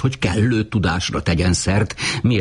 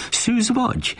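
astonished question. A narrator's reading. Woman questioning Uri in disbelief, astonished high pitch. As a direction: voice leaping high in surprise. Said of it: "kérdezte a simogató csodálkozva".

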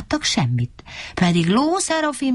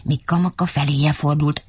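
ordinary delivery, nothing marked

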